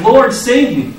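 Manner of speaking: sinking man calling out; cry for help. Retold or imitated. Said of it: "he cried".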